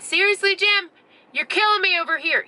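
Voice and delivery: high-pitched retold exasperation; pissed off. Tim woken by smoke in the tent, mock pissed off. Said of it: "he's getting all pissed off".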